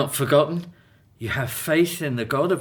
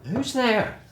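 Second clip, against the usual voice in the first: sharp call through the door, higher; wary call; 9 semitones higher than usual. Acted. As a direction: call out to a stranger at night. Late knock at the door.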